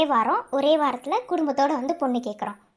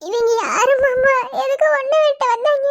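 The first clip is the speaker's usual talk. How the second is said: frightened cry; 12 semitones higher, 8 dB louder than usual. Acted. Wounded woman frightened by attackers.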